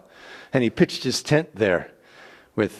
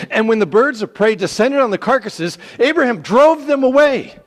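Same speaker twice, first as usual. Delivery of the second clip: climbing higher and louder in excitement; dramatic narration; 11 semitones higher, 9 dB louder than usual. A narrator's reading. Narrator tells how Abram drives off the birds, rising excitedly. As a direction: build to the climax as he drives them off.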